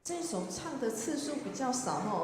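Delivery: ordinary delivery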